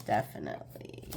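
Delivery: soft breathy whisper of Definitely. Pretend. Reader echoing herself to viewers in a whisper.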